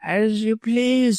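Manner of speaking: high, slow, careless drawl; feigned carelessness. A narrator's reading. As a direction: draw it out as if careless. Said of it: "with apparent carelessness".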